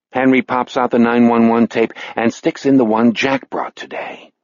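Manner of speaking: plain reading voice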